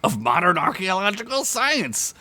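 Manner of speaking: mild matter-of-fact tone. Acted. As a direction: lecture the orc. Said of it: "Skunk said matter-of-factly".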